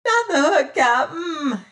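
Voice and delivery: high, loud terrified wail. Acted. Boatswain waking and begging the captain, a terrified wail. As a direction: wail it in panic. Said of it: "he wailed".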